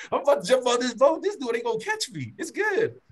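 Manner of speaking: fast, high, gleeful boast; excited. Retold or imitated. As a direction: rush it out, thrilled to escape. Excited retelling of plan to flee.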